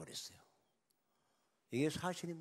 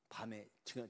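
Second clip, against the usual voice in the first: normal talking voice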